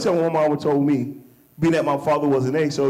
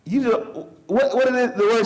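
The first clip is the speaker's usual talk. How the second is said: higher, searching for the word like his wife; imitating wife; 6 semitones higher than usual. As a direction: play his wife hunting for a word, lightly voiced. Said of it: "my wife tell me this a lot".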